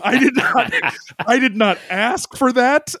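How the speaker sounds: high, bewildered protest; high-pitched amused bewilderment; incredulous. Real, not acted. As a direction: squeak out your disbelief. Recounting bewildered reaction.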